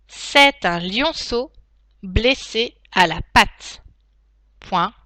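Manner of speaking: slow dictation, each chunk spaced; dictating. Shown as a demonstration. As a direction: dictate slowly with punctuation spoken. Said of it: "passer à la dictée en elle-même".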